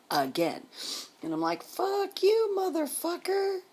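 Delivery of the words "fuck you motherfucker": squeaky, much higher-pitched retold fuck you; high mock yell. Telling her ex Tim to back off, in a high mock yell. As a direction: spit it out in a high shrieking pitch.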